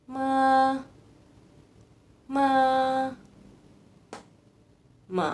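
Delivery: Mandarin ma held level and even; first tone demo. Shown as a demonstration. Teacher showing students the first tone, level and held. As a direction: model the first tone on ma, steady pitch. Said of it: "Untuk pembacaannya, ini kayak gini".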